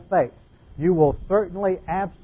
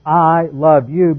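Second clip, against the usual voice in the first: I love you stretched slowly and louder, each word weighed; slow, deliberate; 6 dB louder, much slower than usual. Shown as a demonstration.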